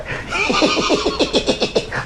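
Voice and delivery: very high giddy giggle; giddy laugh. Acted. Lovestruck clerk giggling about Afrah, very high giggle.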